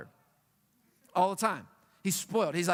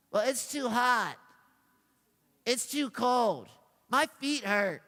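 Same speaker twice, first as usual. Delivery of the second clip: slow, drawn-out mock whine; put-on petty moaning, words stretched out; whiny voice; slower than usual. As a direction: whine like a chronic complainer. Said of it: "That's my whiny voice".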